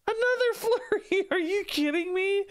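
high-pitched shock at the draw; stunned disbelief. Real, not acted. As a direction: squeal in disbelief at the bad luck. Bad card draw in game.

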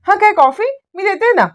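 high, quick eager offer; eager high-pitched offer; eager jump-in. Acted. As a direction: blurt it out, keen to serve. Sayali jumps at coffee for husband.

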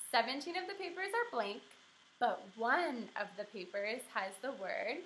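careful, sing-song teacher to her class. Acted.

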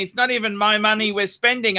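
ordinary delivery

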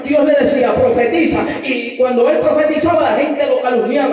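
loud, driving sermon delivery; fiery preaching. Acted. Preacher telling the church about Jeremiah, full-force preaching.

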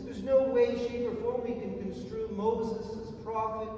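ordinary delivery

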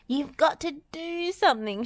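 high-pitched panicky plea; distressed little high voice; shrieking. A narrator's reading. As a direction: squeal it urgently. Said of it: "shrieked Dizzy".

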